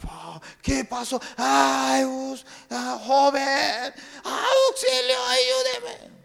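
high, frantic plea; pleading for help. Acted. Castaway begs rescuers in boat, high frantic plea. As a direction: beg the strangers to save you.

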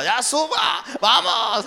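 high, loud laughing command; wife bundling him off; wife ordering. Retold or imitated. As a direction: hurry him into the car, high and bossy.

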